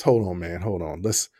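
lightly voiced dad, low and soft; a hint of a father hushing his son; dad calming down. Dad calming son after hard foul.

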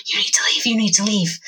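whispered warning; urgent whisper. Acted. Winston warning the party to go, urgent whisper. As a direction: whisper it as a hushed plea to get out. Confused old man warns party to go.

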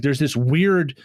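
usual speaking voice

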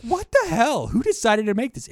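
high, incredulous what the hell; mock disbelief. To his friend about the film, mock disbelief. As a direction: act baffled at monkey people walking around. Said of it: "You're like".

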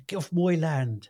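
slow, mock Wiltshire farmer accent. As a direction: drawl it like a Wiltshire farmer shooing you away. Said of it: "They say down here".

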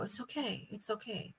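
quietly soothe, imitating a comforting voice; soothing reassurance. Voice reassures her in retelling.

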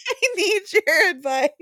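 high-pitched plea through laughter; laughing plea. Real, not acted. Begging Doctor Rachel to rule on a word, laughing plea. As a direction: beg playfully, voice rising with laughter. Mock plea for absent expert's advice.

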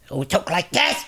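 a lightly put-on sports sidekick voice; soft, squeezed impression of a radio sidekick; Steve Geldstein voice. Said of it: "that voice, that was Steve Geldstein".

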